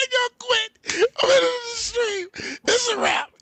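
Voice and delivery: high, loud laugh-crying, barely getting words out; losing it completely mid-stream; helpless laughter. Real, not acted. Host cracks up at friend, laughing till he cries.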